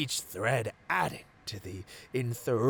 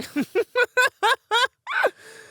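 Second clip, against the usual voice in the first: long, much higher, louder evil cackle; villain cackle; 9 dB louder than usual. Acted. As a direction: throw your head back in a triumphant villain laugh. Villain gloating at caged hero.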